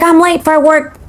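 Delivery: high-pitched acted-out complaint; retold complaint. Retelling her rushed morning to co-host, high-pitched complaint. Reenacts own complaint in the story.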